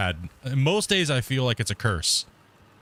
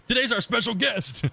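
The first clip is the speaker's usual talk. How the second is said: put-on squeaky, high anime girl voice; a light cute anime squeal; 8 semitones higher than usual. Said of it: "keep doing the anime voice".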